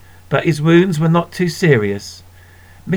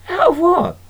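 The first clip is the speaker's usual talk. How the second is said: put-on much higher, boyish indignant squeak; indignant little boy. Bobby to his sister, indignant boy's squeak. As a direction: pipe up like a put-out young boy.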